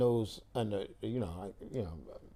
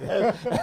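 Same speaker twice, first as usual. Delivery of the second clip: laugh; 10 semitones higher, 4 dB louder than usual. Real, not acted. Laughing at the photos of himself.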